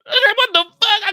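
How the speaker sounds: very high, loud disbelieving shriek; shocked outcry. Retold or imitated. Shocked at negative balance.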